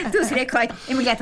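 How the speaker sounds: amused chuckle at her own story; laughing. Real, not acted. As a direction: chuckle through it. Laughing at own wedding tale.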